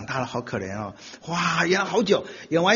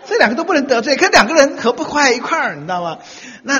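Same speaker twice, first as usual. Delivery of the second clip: ordinary talk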